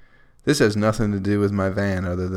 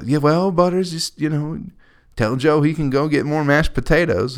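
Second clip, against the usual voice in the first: lightly higher mock mom voice; imitating mom; 7 semitones higher, 3 dB louder than usual. Mom telling Butters about mashed potatoes, in lightly raised mock voice. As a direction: mimic mom telling the dog to pass it on. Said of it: "You go".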